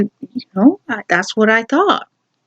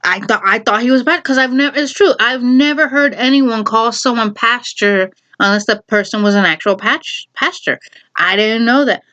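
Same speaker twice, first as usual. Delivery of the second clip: ordinary delivery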